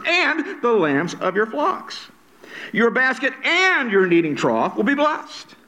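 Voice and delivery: "and" stretched long and high; slow reading that leans on each "and"; stress on "and". Shown as a demonstration. Preacher reads the blessings aloud, hammering "and". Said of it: "I'm gonna be emphasizing the word "and".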